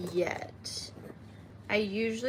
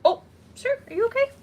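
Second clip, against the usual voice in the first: high, loud pet voice; baby talk; 13 semitones higher, 8 dB louder than usual. Pretend. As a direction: coo at the little creature. Talking to a scorpion.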